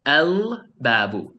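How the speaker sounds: "El babu" with the L held clearly; slow "El babu", every sound of "El" heard. Shown as a demonstration. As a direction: linger on the L of "El babu". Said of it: "I'm pronouncing the alif lam clearly".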